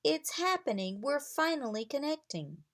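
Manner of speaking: eager and overjoyed; thrilled. A narrator's reading. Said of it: "she cried, smiling".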